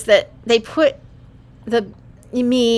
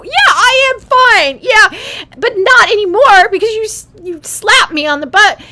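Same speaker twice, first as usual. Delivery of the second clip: put-on drip sarcasm as a tiny baby; sarcastic newborn; 10 semitones higher, 8 dB louder than usual. Newborn retorting to the staff, high and sarcastic. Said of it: "You know".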